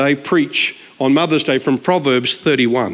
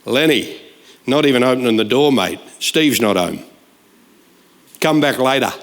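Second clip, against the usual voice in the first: much lower, blunt brush-off; wife refusing the youth group boy at the door, blunt; firm wife; 7 semitones lower than usual. Retold or imitated. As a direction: shut him down flat and firm. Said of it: "chicken and fish lady said".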